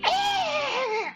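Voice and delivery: very high, screeching cackle; horrendous shrieking laughter; witch cackle. Acted. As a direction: shriek like a pack of little hags. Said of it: "do the same thing".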